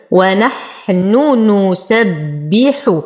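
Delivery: slow ونحن نسبح with each nun sounded; two clear nuns. Shown as a demonstration. Teacher reciting for students, ونحن نسبح with distinct nuns. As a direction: keep both nuns apart in ونحن نسبح. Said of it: "انتبه هنا لتلاقي نونين".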